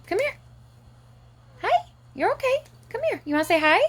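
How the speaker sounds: high cooing voice for the cat. Real, not acted.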